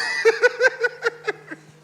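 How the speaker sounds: loud high squealing laugh; real laugh breaking out; high burst of laughter. Real, not acted. Speaker laughing at her own story, high loud cackle.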